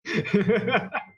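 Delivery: high loud laugh; burst of laughter. Real, not acted. Laughs at ship name.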